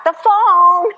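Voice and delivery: high, loud exasperated cry; mock outburst. Frazzled at home over the phone, loud. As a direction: shout it like the phone caught you.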